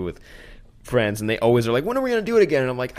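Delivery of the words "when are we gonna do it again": eager. Retold or imitated. Friends' eager quote.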